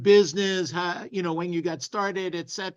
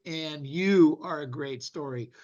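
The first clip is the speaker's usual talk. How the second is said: usual speaking voice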